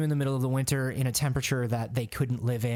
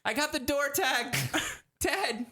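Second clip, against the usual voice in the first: high, pitiful report; mock-wounded high voice; acted pained whimper; 10 semitones higher than usual. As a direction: squeak it out after a beating. Victim reporting after acted beating.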